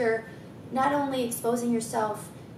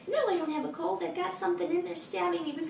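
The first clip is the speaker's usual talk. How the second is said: higher, fast mock kid protest; insistent little boy; 7 semitones higher, faster than usual. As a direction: play the six-year-old who won't be argued with. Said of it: "And he was like".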